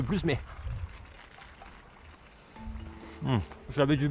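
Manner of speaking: talking between bites; muffled hum while chewing; mouth full, chewing. Real, not acted. Seen 2: Bites at -3 to -1; lips closed, chewing at 0.1-0.8.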